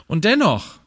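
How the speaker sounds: ordinary talk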